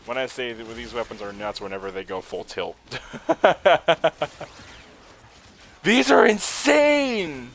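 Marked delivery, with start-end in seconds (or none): 2.8-4.6 loud, high, rapid laugh; gleeful staccato cackle; delighted laugh. Real, not acted. Delighted by the damage. 5.8-7.5 high, loud, drawn-out exclamation; slow, soaring yell of excitement; thrilled shout. Real, not acted. Thrilled by overpowered weapons.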